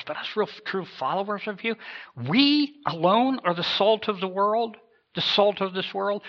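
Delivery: high-pitched, disbelieving question; incredulous. Acted. True follower asking Jesus if they alone are salt, incredulous.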